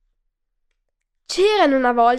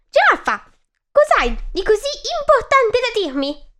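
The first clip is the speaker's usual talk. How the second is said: much higher, squeaky angry mouse voice; annoyed little mouse; 9 semitones higher than usual. Acted. Mouse, interrupted while shaving, squeaks at giraffe annoyed. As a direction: snap at the giraffe like a tiny irritated mouse. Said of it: "chiese il roditore".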